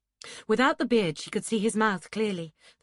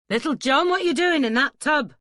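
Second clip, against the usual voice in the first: high, loud shout across a yard; calling out sharply; 9 semitones higher, 10 dB louder than usual. A narrator's reading. Duncan catching Little John in the laundry tub, loud high call. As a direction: call out a startled demand, voice raised high.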